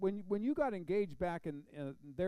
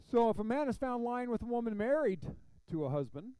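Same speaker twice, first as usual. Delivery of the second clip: loud, raised-pitch Bible reading; scripture reading; 8 semitones higher, 7 dB louder than usual. Acted. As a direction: read the verse out to the room, pitched up. Reads scripture aloud to congregation.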